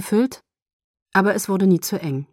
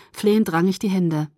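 ordinary delivery, nothing marked

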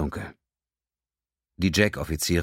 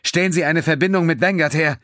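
higher, louder demand; furious demanding order; growing anger; 12 semitones higher, 7 dB louder than usual. A narrator's reading. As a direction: demand it, temper rising. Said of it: "verlangte sie mit wachsender Wut".

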